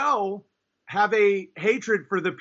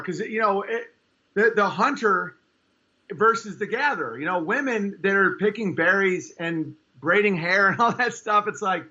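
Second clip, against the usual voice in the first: ordinary talk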